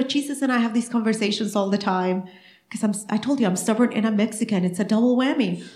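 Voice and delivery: usual speaking voice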